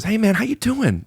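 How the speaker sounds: high, eager greeting with chin-up energy; very connected. Retold or imitated. Agent greeting a new client in the room, very connected. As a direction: greet like you're deeply connected. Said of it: "Very connected. You know, eyebrows up".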